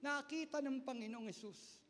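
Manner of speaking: ordinary talk, nothing marked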